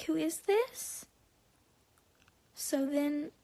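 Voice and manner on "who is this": high, slow feigned cluelessness; higher, drawn-out mimicking question; mocking imitation. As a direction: drag it upward into a mock-innocent question. Mimicking friend acting clueless.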